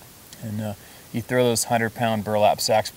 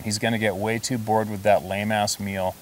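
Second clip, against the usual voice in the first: ordinary talk, nothing marked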